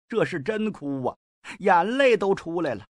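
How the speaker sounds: ordinary delivery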